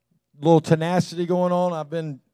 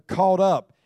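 "caught up" repeated with weight; emphasis on caught up; 4 semitones higher, 5 dB louder than usual. Shown as a demonstration. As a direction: press down on "caught up" once more. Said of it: "Let me just kinda emphasize that word".

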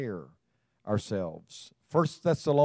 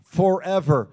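scripture reading with force; 4 semitones higher, 4 dB louder than usual. Acted. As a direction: proclaim the verses to the congregation. Reads scripture aloud to congregation.

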